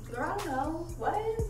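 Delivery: ordinary talk, nothing marked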